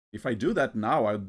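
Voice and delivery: ordinary talk, nothing marked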